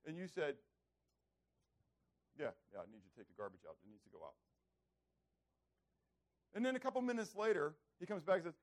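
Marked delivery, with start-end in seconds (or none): 2.4-4.3 low, soft, offhand answer; easygoing low-key reply; casual parent. Acted. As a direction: toss it off quietly and quickly. Parent's first, unbothered answer to child.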